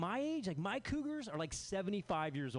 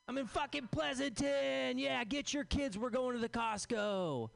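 mock suburban bro; 6 semitones higher than usual. Comic calling friends out to the suburbs, mock hyped. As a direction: ham up the thrill of Costco. Mocking suburban invite, loud.

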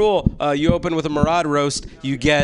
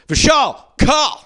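slow, louder boxing-announcer intro; 11 semitones higher, 7 dB louder, much slower than usual. Acted. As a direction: stretch out the name like a ring announcer. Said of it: "don't know why I'm doing this voice".

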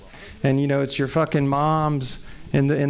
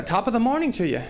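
higher, badly faked British greeting; attempted British accent; 7 semitones higher than usual. Comic answers a man asking for money, bad British accent. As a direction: try a British accent and miss. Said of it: "I can't do a fucking British accent".

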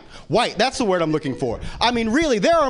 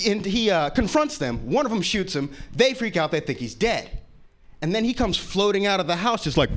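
usual speaking voice

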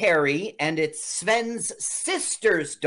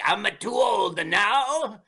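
put-on old man voice; 5 semitones higher than usual. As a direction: bellow it like a worn-out old athlete. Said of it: "He's like".

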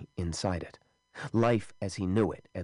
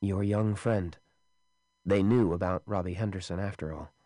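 ordinary delivery, nothing marked

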